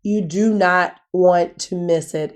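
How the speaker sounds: ordinary talk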